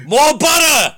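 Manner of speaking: a high, loud playful call; mock shout. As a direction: bellow it like a greedy demand. Calling for butter mid-joke.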